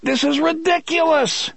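very high, exasperated shout. A narrator's reading. Eddie handed a knife by Roland, exasperated shout. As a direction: cry out in outraged disbelief. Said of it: "Eddie shouted".

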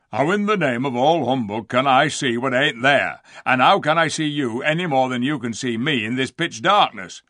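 loud, grumbling rustic dwarf; exasperated dwarf. A narrator's reading. Diggle answering Lucy, exasperated. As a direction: argue back with rude exasperation.

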